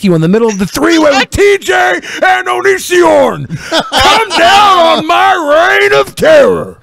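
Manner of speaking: high, rising hammed-up shout, slowing at the end; mock wrestling threat shout. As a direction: build it into a wrestler's roar.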